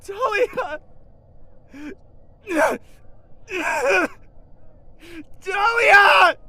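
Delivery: very high, loud crying scream; anguished scream. Acted. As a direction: scream her name in anguish. Brother screams for sister.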